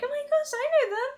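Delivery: high-pitched imitation of a thrilled fan; excited recognition. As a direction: squeal it like a viewer recognising someone. Acting out viewers recognising voices.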